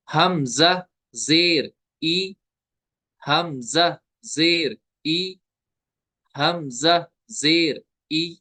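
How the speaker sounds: हमजा जेर ई slowly, word by word, ई neither stretched nor jerked; measured spelling chant with a medium-length ई; gentle Arabic reading. Shown as a demonstration. Teacher modelling the zer reading, slow and measured. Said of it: "ऐसे पढ़ेंगे".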